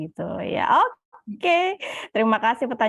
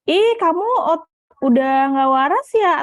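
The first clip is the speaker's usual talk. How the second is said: higher-pitched mock astonished "ih"; mock nosy sneer; 7 semitones higher, 4 dB louder than usual. Moderator voicing people who mock visits to a psychologist, mock sneering. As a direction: imitate a gossip's scandalised question.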